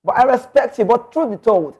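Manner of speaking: usual speaking voice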